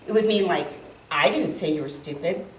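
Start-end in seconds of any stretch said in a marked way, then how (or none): 1.1-2.5 "I" punched, the rest dropping low; stress on I. Shown as a demonstration. Speaker shows someone else said it, stress on "I". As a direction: lean hard on "I". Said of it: "put the emphasis ... on the first word".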